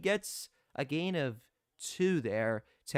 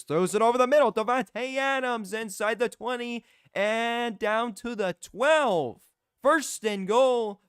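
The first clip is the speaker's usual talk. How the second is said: rising, louder play call; excited call; 7 semitones higher, 5 dB louder than usual. Real, not acted. Commentator calling Adams' big catch, excited. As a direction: lift the voice as the catch goes long.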